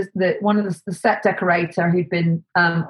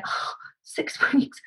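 breathless whisper with a laugh, slow; whispered disbelief; 8 semitones higher, 7 dB quieter, slower than usual. Retold or imitated. Looking at the empty site, whispered laughing disbelief. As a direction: gasp it out in stunned disbelief. Seeing the bare building site.

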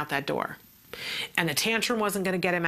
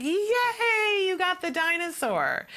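cheering yay; 12 semitones higher, slower than usual. Retold or imitated. Therapist cheering the toddler on, a high bright yay. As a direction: praise the child with a big yay. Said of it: "And she went".

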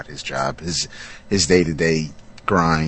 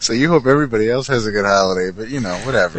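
mocking; 4 semitones higher, 4 dB louder, faster than usual. Mocking co-host's shrug.

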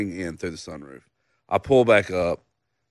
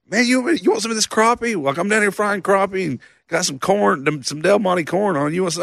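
much higher, easygoing friend offering food; cheerful buddy voice; 10 semitones higher than usual. Retold or imitated. Friend offering fried crappie, high cheery buddy voice. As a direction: lift the voice way up like a chipper pal.

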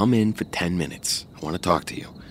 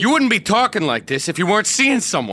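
much higher, louder accusing yell; jealous shout; 12 semitones higher, 5 dB louder than usual. Acted. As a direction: explode with jealousy. Jealous quarrel, Joe accusing.

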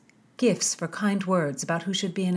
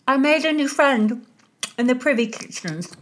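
high, louder sly boast; sly plump lady; 7 semitones higher, 6 dB louder than usual. A narrator's reading. Mary tells Constance where she got the sweets, high and sly. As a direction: brag about the secret friend, high.

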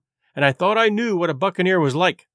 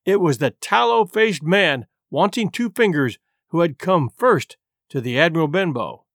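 ordinary delivery

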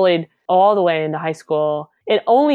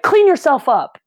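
high, loud retold command; stern order; 9 semitones higher, 6 dB louder than usual. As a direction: order the beaten bully about, pitched up. Retells ordering the crying bully.

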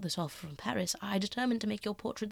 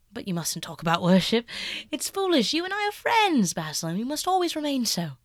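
much higher, louder, insistent youth; eager Dorian; 5 semitones higher, 7 dB louder than usual. Acted. Dorian insisting to Basil they stay friends, high and loud. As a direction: press it, protesting.

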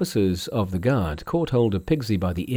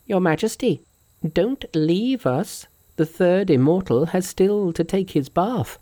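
high-pitched, taunting monkey; Monkey's character voice; 8 semitones higher than usual. A narrator's reading. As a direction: call the king back in a high voice.

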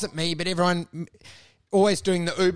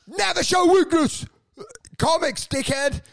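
high mock shout; retold yell; 9 semitones higher than usual. Comic berating another comic backstage, mock yelling. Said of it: "like, yelling".

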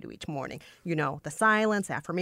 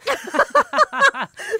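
loud high laugh; 7 dB louder than usual. Real, not acted. Hearing the clip.

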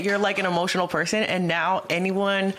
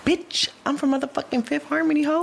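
higher-pitched, sassy put-down; playful imitation of an offended star; mock indignant boast; 7 semitones higher than usual. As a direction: brag as if insulted, pitched up. Imagined boast the singer never made.

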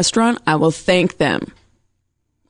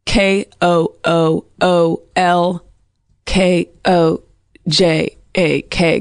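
spelled out slowly; slower than usual. Shown as a demonstration. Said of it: "he is on Twitter".

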